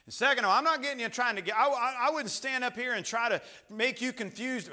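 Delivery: loud, high-pitched pulpit delivery; urgent preacher at full volume; full-force preaching. Real, not acted.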